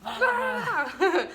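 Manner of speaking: loud high fed-up ah with a laugh; mock exasperated groan. Wife mimicking her huffy reply to husband: mock groan. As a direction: blurt a hammed-up frustrated ah. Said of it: "And I'm like".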